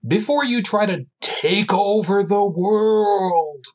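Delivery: dramatic announcer. Shown as a demonstration. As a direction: intone it like a movie trailer. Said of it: "Oh, crap, I should really do this".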